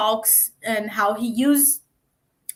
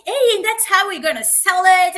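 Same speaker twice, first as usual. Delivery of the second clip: high, loud sales energy; pumped-up closer line; energetic pitch; 8 semitones higher, 8 dB louder than usual. Shown as a demonstration. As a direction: sell it to the investors with punch. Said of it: "put a little bit more energy".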